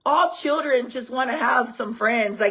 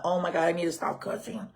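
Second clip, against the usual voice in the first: self-scolding aside; 7 semitones lower, 9 dB quieter than usual. Retold or imitated. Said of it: "So I'm like".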